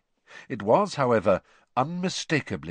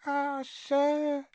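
very high squeaking voice; a house elf meeting someone famous; tiny elf squeak. Acted. Winky introducing herself to Harry, awed squeak. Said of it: "said Winky, shaking her head".

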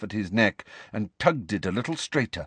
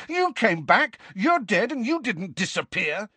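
high-pitched, accusing insistence; angry; 12 semitones higher than usual. A narrator's reading. Harry argues that Nick returned, angrily. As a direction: argue heatedly, voice raised in pitch. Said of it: "said Harry angrily".

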